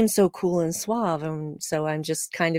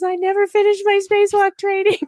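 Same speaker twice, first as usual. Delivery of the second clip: high, louder mock panic; freaking out; 13 semitones higher, 5 dB louder than usual. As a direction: blurt the confession in alarm. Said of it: "she starts to freak out".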